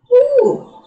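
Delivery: high whooping cheer; excited cheer. Real, not acted. Cheering mid exercise count.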